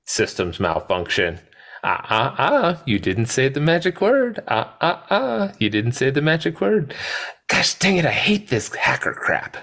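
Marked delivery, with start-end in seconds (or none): 1.8-9.6 high, taunting sing-song; mock the player in a high whiny voice; Nedry impression. Podcaster doing the Nedry line, high taunting whine. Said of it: "Nedry, as he sets up".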